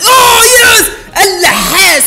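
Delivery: very high, loud victory scream; ecstatic shout. Real, not acted. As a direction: yell in joy at the surprise win. Sudden success in game. (صرخة فرح عالية جداً)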